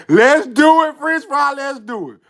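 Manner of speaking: rally your buddy, then ease off; keen acted pep talk; eager agreement. Same agreement repeated, now louder.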